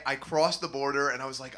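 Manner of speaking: ordinary talk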